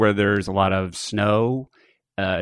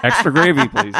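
mock order; 10 semitones higher, 6 dB louder than usual. Ordering at KFC.

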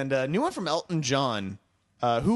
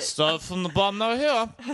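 drunk [name] impression; 7 semitones higher than usual. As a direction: lean into the drunk rapper slur, brighter and bigger. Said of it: "he sounds like he's got drunk Shannon voice".

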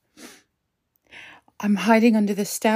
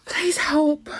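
higher, breathy whispered plea; terrified whisper; 5 semitones higher than usual. Acted. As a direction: whisper a desperate plea for help. Hiding, begging for help.

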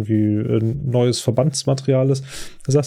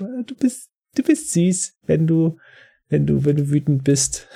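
teasing brother; 5 semitones higher than usual. Retold or imitated. Ben drifts off, flirty teasing.

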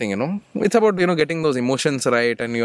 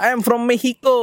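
Mexican accent; 6 semitones higher than usual. Shown as a demonstration. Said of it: "how they say in Mexican accent".

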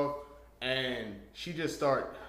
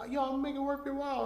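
put-on girl's voice; 13 semitones higher, faster than usual. Girl voiced inviting him.

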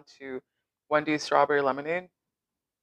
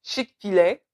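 put-on higher-pitched playful French accent; mock French accent; 4 semitones higher, 3 dB louder, slower than usual. Co-host insisting the chain is French, mock French accent. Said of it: "say it in a French accent".

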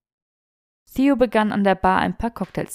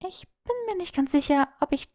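put-on high, fast protesting young woman; a flustered girl caught out; flustered Hermine; 7 semitones higher than usual. A narrator's reading. Hermine, caught off guard by a test, high flustered protest.

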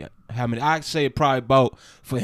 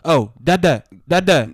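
put-on toddler calling dad; 4 semitones higher, 6 dB louder, slower than usual. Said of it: "I'm like".

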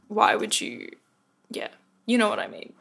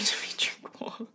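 whispered quickly with a laugh; half-laughing whisper to the listener; amused whisper; 7 dB quieter than usual. Real, not acted. To listeners hearing her drink water, an amused whisper.

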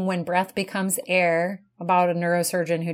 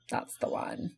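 whispering; 13 dB quieter than usual. Real, not acted. Echoed whispered.

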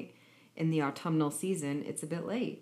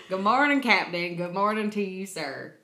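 mock formal greeting; 3 semitones higher, 7 dB louder than usual. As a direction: greet the captain with mock ceremony. Keeps the accent bit going.

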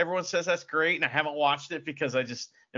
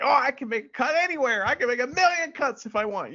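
mock giddy editor; 8 semitones higher, 3 dB louder than usual. Mock quote of overeager film editor.

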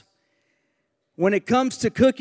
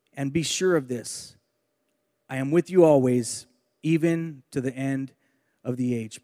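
measured, lower-pitched Bible reading; scripture reading; 6 semitones lower than usual. Acted. Preacher reading the Great Commission to his congregation, measured scripture reading. Reads Bible verses aloud.

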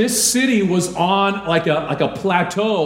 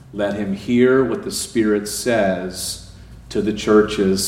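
slow, lowered, measured Bible reading; solemn scripture reading; 5 semitones lower than usual. Acted. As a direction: read the holy text gravely, letting each phrase land. Reads scripture aloud to congregation.

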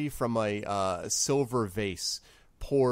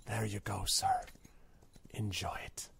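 the barkeep murmurs much softer, almost sacred; hushed reverent service; 2 semitones lower, 11 dB quieter than usual. Acted. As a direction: hand it over in a hush.

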